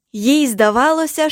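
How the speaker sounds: ordinary delivery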